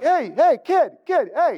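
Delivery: mocking call. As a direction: call after the kid to mock him. Bullies calling out to him.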